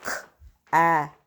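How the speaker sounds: each letter sound said separately, with pauses between. Shown as a demonstration. Teacher to pupils, sounding out a word letter by letter. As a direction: split the word into its single sounds for the class. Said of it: "my next word I'm sounding out is".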